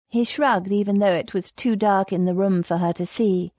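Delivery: ordinary delivery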